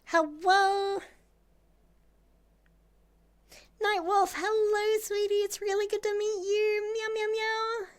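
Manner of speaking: high, sweet cutesy greeting voice; cutesy nya speak. Acted. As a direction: coo at the raider like a kitten. Greeting raider in cute voice.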